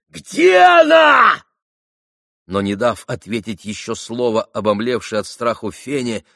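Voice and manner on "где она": slow, very loud high scream; frantic yell. A narrator's reading. Mitya demanding of Fenya where she is, frantic yell. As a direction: bellow the question, drawing it out. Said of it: "завопил Митя".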